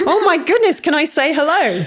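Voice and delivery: high, delighted squeal; thrilled to meet a pet; excited. Real, not acted. Excited to greet the cat.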